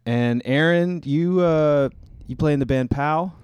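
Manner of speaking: ordinary delivery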